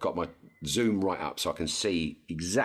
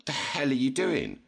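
indignant; 9 semitones higher than usual. Retold or imitated. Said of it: "I turned around and I went".

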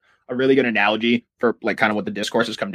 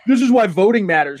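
preachy media voice; 7 semitones higher, 5 dB louder than usual. Retold or imitated. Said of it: "media discourse was like".

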